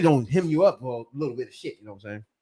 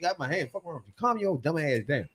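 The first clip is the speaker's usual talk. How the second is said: quiet grumble; 8 dB quieter than usual. Retold or imitated. Quiet retort in arrest.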